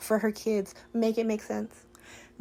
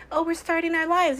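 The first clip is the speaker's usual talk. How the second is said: imitating him, higher and bouncy; mock giddy; 9 semitones higher, 4 dB louder than usual. Mocking TJ's excitement in a high giddy voice. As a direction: play it naive and excited, pitched high. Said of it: "He would've been like".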